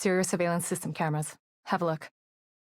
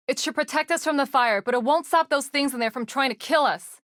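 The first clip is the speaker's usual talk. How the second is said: loud and raised, over roaring flames; shouting over fire; 6 semitones higher, 5 dB louder than usual. Acted. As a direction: call out loud above the fire.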